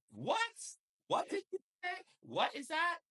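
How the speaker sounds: high squeaky indignant questions; mock outraged fiancee. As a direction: play the offended partner, pitch way up. Voicing his fiancee's reaction.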